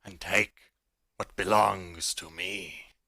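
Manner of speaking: slow, loud, menacing dragon; booming dragon voice. Acted. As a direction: boom it out slowly like a huge beast. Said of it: "booms out loud in the tunnel".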